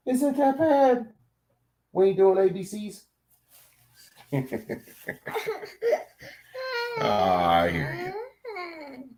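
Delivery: high, doting voice for the dog; pet talk. Real, not acted. Reviewer turns to coo at his dog in a high pet voice. Talking to pet mid-video.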